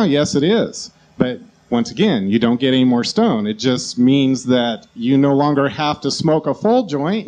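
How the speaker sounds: ordinary delivery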